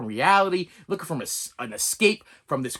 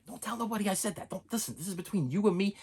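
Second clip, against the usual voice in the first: very soft, conspiratorial aside; hushed secret; 10 dB quieter, faster than usual. Pretend. Secret between host and viewers.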